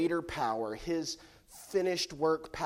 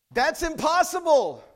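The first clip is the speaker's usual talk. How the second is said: put-on kids protesting; 12 semitones higher, 10 dB louder than usual. Kids voiced in sermon illustration.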